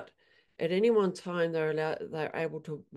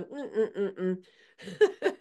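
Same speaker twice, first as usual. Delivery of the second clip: mock horse squabble; 3 semitones higher, slower than usual. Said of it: "And so they'd go".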